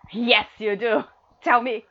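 loud insistent demand. Acted. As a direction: bark it as an order. Demands an answer louder.

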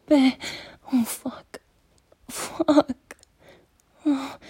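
ordinary talk

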